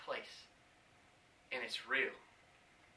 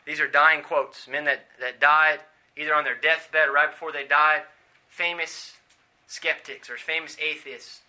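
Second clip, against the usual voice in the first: ordinary delivery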